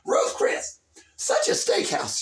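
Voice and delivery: high, drawn-out mock scold; hammed-up scolding mom; scolding parent. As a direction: tut at her like a fussy mother. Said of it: "her parents keep scolding her".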